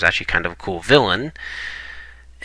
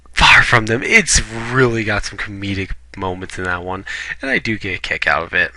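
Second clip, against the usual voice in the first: ordinary delivery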